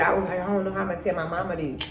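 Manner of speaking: normal talking voice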